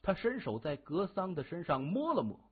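ordinary delivery

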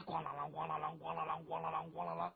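low, monotone jangle of bells; imitated clanging horse bells. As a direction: chant the clanking bells on one low note.

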